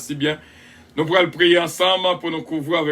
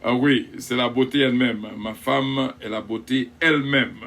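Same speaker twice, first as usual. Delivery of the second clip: usual speaking voice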